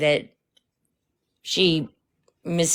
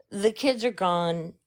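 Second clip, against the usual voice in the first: ordinary delivery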